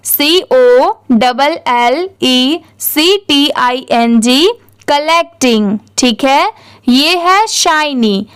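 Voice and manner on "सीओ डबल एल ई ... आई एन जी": slow, letter-by-letter spelling; spelling out for repetition. Shown as a demonstration. Teacher has learners repeat the spelling along, slow spelling. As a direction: spell it out slowly, pausing between letters. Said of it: "spelling मेरे साथ repeat करिए".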